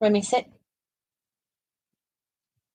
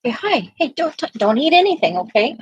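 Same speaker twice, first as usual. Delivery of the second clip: talking to a dog; 6 semitones higher, 4 dB louder than usual. Real, not acted. Talking to puppy, telling it off.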